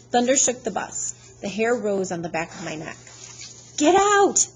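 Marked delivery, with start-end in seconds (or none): 3.8-4.5 very high, loud urgent shout; yelling. Acted. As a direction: scream at him to flee. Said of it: "Annabeth yelled at me".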